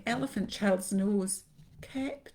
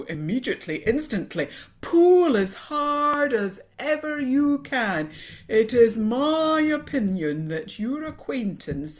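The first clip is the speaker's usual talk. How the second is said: loud, stretched, declamatory snake voice; pompous python; 4 semitones higher, 10 dB louder than usual. A narrator's reading. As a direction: proclaim urgent advice at full voice, drawing out key words. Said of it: "This is the way the bi-coloured python rock snakes always talk".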